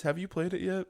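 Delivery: ordinary delivery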